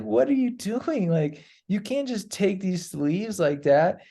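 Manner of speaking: high, slow, stern rebuke; imitating an outraged teacher; scolding maestro. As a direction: lecture him like a shocked elder.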